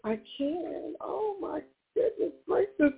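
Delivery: ordinary talk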